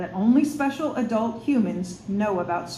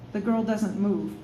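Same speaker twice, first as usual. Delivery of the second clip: plain reading voice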